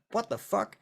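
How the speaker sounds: startled cops. Retold or imitated. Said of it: "they're all like".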